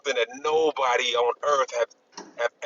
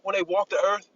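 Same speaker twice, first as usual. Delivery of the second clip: put-on higher, amused look-back voice, remembering an old nightmare; light mock-nostalgic voice, pitched higher; future self looking back; 6 semitones higher than usual. Future people recalling the past, in a higher, lightly amused voice. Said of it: "we're gonna look back and go".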